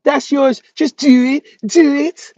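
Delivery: high, loud Baltimore woman in bed, hammed up; exaggerated Baltimore 'do', high and loud; mock Baltimore accent. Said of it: "y'all motherfuckers must sound crazy in bed".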